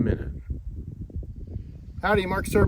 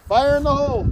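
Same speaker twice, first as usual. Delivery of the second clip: calling out; 11 semitones higher than usual. Real, not acted. Warning before firing the rifle.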